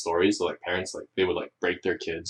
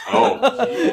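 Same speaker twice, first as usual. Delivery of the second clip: laughing oh; 8 dB louder than usual. Retold or imitated. Reaction acted with laugh.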